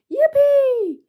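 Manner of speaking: high-pitched, gleeful whoop; excited cheer. A narrator's reading. Spiders cheering as children play football, high excited cry. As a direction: squeal it like a delighted little fan. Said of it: "they cried".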